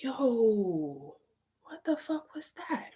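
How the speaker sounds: hushed, high-pitched amazement; soft drawn-out disbelief, voice rising; stunned. Retold or imitated. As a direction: act out quiet astonishment. Retelling stunned reaction to sudden silence.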